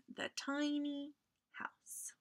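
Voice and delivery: hushed storybook whisper. A narrator's reading. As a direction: breathe the words softly. Narrator drops to whisper.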